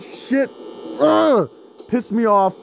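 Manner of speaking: ordinary talk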